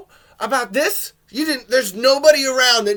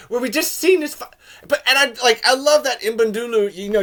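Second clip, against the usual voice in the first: ordinary delivery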